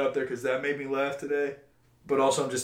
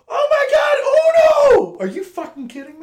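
ecstatic shriek; 11 dB louder than usual. Retold or imitated. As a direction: shriek like a coworker thrilled by a gift. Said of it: "She rips off that wrapping paper".

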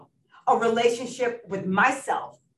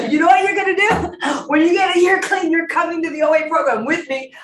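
loud, higher commanding order; bossy sponsor; 7 semitones higher, 8 dB louder than usual. Retold or imitated. [name] voicing her sponsor ordering her to OA, loud and commanding. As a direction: boom it out like a sponsor who doesn't suggest. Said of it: "she tells you".

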